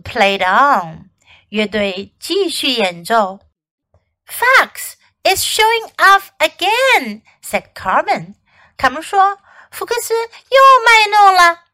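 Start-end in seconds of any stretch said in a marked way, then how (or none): 4.2-7.2 high, slow complaint; exasperated tattling. A narrator's reading. Carmen complaining that Fox is at it, tattling. 9.7-11.7 high, loud complaint; exasperated tattling. A narrator's reading. Carmen complaining to the band about Fox, tattling.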